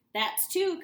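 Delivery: high, loud DJ holler. A narrator's reading. Radio DJ hollering the count to the caller. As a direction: call it out big. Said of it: "Cousin Ralphie hollered".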